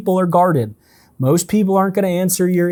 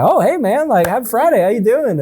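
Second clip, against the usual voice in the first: much higher, matching their excitement; excited greeting; 9 semitones higher than usual. Shown as a demonstration. Salesman mirroring an excited caller, upbeat. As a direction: bounce back their energy. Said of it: "super excited".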